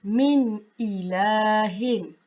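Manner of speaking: min ilahin, each sound clear, no nasal hum; slow, careful Quran recitation; correct reading. Shown as a demonstration. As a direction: read it cleanly, no dengung. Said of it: "bacaannya ialah".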